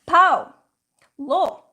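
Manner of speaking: put-on high, loud toddler syllables, one word at a time; a little boy chopping words into single syllables; imitating a toddler. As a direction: call it out like a proud two-year-old. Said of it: "he goes to my uncle and he says".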